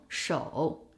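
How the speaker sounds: 首 dipping low, then rising; drawn-out 首 in a falling-rising tone; full third tone. Shown as a demonstration. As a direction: let 首 sink to the bottom and climb back up. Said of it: "首's natural tone is third tone".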